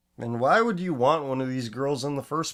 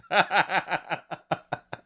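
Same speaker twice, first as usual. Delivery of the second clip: high laugh; 7 semitones higher than usual. Real, not acted. Laughing at girl's profile.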